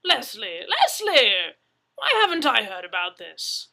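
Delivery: loud indignant Englishman; loud demand. Acted. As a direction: call him out, indignant. Brewer demanding an answer.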